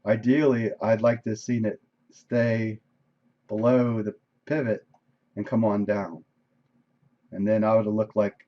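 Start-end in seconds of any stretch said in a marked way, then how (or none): none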